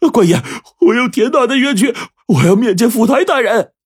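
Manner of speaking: high, loud desperate appeal; pleading cry. A narrator's reading. Blind Wang Mao begs the guard, high pleading cry. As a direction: cry out your grievance to the guard.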